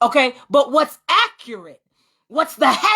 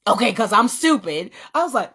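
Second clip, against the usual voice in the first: ordinary talk, nothing marked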